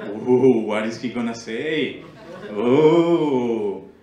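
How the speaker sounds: swooping, drawn-out oohs into a complaint; hammed-up helpless grumbling; mock indignant colleague. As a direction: imitate an uneasy coworker bracing for offense.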